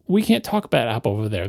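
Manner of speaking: low, soft, dismissive voice; cold official. Retold or imitated. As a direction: brush it off like a gatekeeper. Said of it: "they'll say to you".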